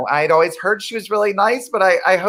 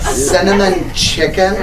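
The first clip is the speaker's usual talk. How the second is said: slow, louder, rising disbelief; amazed repeat; 3 semitones higher, 4 dB louder, slower than usual. Acted. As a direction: echo it in disbelief.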